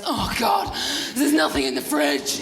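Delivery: a hint of a whining teenager; sulky child voice. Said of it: "a little bit of me that goes".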